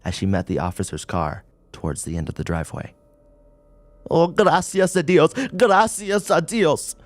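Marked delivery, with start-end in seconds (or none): 4.1-7.0 put-on much higher, louder old woman's cry; Spanish old woman, weeping with relief; tearful Spanish thanks. A narrator's reading. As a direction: cry out thanks to God, distraught. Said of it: "mumbled her thanks in Spanish".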